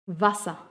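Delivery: "Wasser" ending in an open A; vocalized final R. Shown as a demonstration. Teacher demonstrating "Wasser" with final R as A. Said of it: "termina como un sonido de A".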